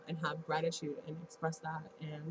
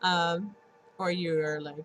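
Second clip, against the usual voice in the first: ordinary talk, nothing marked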